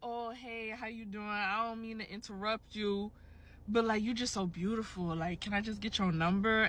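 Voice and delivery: soft, smooth-talking suitor, lightly voiced; imitated pickup man. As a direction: lightly mimic a guy chatting someone up, hushed. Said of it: "He literally was like".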